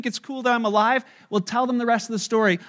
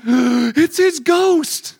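very slow, higher, louder spooked line; mock frightened gasp; 4 semitones higher, 7 dB louder, much slower than usual. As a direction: drag it out in mock terror.

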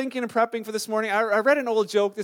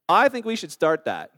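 ordinary delivery

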